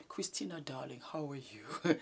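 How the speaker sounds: soft, breathy, posh-style whisper to a friend; exaggerated elegant whisper. Shown as a demonstration. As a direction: overdo the hush as a joke. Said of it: "I'm not going to speak to Christina like this".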